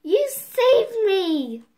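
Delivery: loud, slow happy cry; joyful shout. A narrator's reading. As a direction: call out the relief. Hansel's joy retaken.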